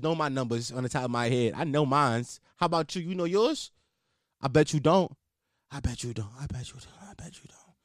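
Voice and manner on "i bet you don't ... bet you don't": quieter each time until whispered; fading to a whisper. Shown as a demonstration. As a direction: trail off into a teasing whisper. Teasing line repeated, fading out.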